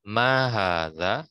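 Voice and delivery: Mahadza, slow, with the a in ma, ha and za held long; long vowels drawn out. Shown as a demonstration. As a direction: read Mahadza slowly, holding each long a. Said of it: "gitu cara bacanya".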